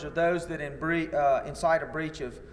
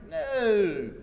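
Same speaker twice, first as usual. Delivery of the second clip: mock denial; 5 semitones higher than usual. Deputy denying the arrest to plaintiff's lawyer, mock innocent protest. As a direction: act out an overdone denial. Officer's denial in retold deposition.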